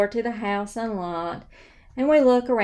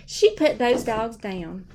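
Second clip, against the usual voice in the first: ordinary delivery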